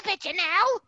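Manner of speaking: very high, fast mock-mother screech; horrible mom. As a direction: nag in a hammered-up falsetto mom voice. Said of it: "or a horrible mom".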